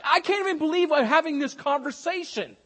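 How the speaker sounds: high, loud can't-believe-it; exasperated at having to say this; exasperated disbelief. Retold or imitated. Paul reprimanding the church, high exasperated disbelief. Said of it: "It's like".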